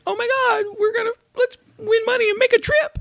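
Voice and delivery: put-on very high, fast, squealing ad-girl voice; ditzy commercial girl. Mocking a girl in a phone-game commercial, squealing high and fast. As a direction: squeal it like a giddy ad actress.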